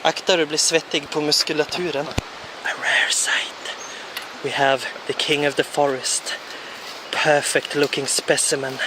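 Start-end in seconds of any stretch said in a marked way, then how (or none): 2.7-8.8 hushed wildlife-film whisper; documentary whisper. Acted. Spotting the friend, hushed documentary whisper. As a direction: whisper like stalking a rare animal.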